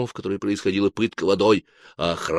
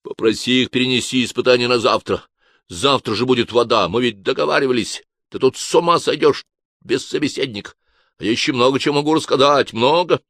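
louder, higher urgent pleading; barely held back shout; 5 semitones higher, 5 dB louder than usual. A narrator's reading. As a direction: hiss it, nearly shouting. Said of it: "еле сдержал крик".

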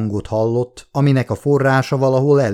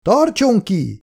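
shouting; 10 semitones higher, slower than usual. A narrator's reading. Scientist shouting down to the trapped soldier, high. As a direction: shout encouragement down the hole. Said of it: "kiáltotta".

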